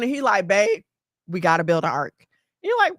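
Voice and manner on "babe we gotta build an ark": low, earnest husband voice; lightly voiced solemn husband; husband's announcement. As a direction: break it to her in a low, level voice. Husband voiced in retold story.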